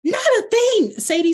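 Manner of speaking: mock-indignant tease; teasing outburst. Real, not acted. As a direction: fire back at the friend in jest. Teasing friend who answered.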